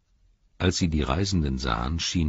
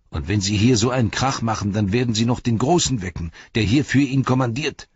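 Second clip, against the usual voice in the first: raised warning; 6 semitones higher, 6 dB louder than usual. Acted. Hobbit warns about noise.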